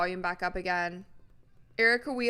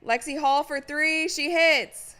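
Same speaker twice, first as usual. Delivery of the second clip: higher and louder as the shot drops; excited play call; 4 semitones higher, 7 dB louder than usual. Real, not acted. Streamer calling a made three, excited. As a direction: call the basket with rising excitement.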